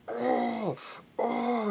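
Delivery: high strained cries of rage; furious grunts. Acted. Bane squeezing potato, raging cries.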